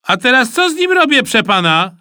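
put-on loud, high boy's question; calling out. A narrator's reading. Pupil asking the supervisor what next, calling out. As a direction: call out eagerly across the yard.

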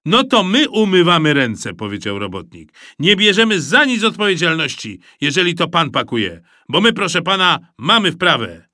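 loud, huffy voice; offended mover. A narrator's reading. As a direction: wash your hands of it proudly. Worker washing hands of it.

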